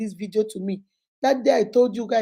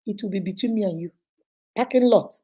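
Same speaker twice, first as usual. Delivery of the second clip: ordinary delivery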